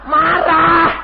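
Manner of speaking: high, loud punch on the answer; emphatic exclamation. Real, not acted. Preacher tells congregation why hearts fail, emphatic cry. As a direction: ask it high, then burst out with the answer.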